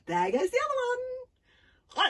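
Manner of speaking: slow, high, loud gleeful cry. A narrator's reading. Girl delighted at cutting his second sleeve, high and slow. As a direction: crow it with delight.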